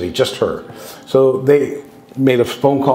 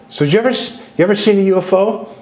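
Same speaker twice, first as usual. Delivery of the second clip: much higher, sly, teasing quiz; coy question; 10 semitones higher, faster than usual. Retold or imitated. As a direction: fish for a secret with innocent mock curiosity. Coyly quizzing the alien's wife.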